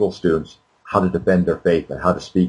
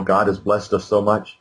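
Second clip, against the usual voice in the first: normal talking voice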